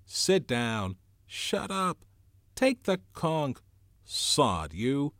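high, loud heckling from a crowd; acted shouts of an unruly assembly; angry outburst. A narrator's reading. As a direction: fire off angry orders like an uproar breaking out. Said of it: "the storm broke".

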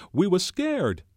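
high, loud excited insistence; excitedly. A narrator's reading. Piggy insisting to Ralph they were frightened, excitedly. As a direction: burst out with it, pitch way up. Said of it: "said Piggy excitedly".

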